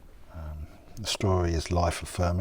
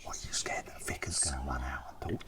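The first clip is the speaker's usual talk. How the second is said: hushed church whisper; 6 semitones lower, 11 dB quieter than usual. Real, not acted. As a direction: whisper so no one hears. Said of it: "why are we whispering".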